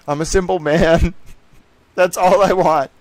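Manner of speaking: laughing. Real, not acted. Laughing at own joke.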